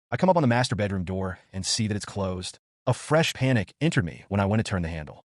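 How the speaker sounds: usual speaking voice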